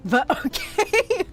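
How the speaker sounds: high laughing okay, drawn out slowly; a giggly, stretched out okay. Real, not acted. Laughing at harsh critique.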